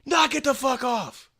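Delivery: high, drawn-out yell across the park; retold scream. Yelling at bottle-throwing skateboarders, retold scream. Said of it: "so I scream".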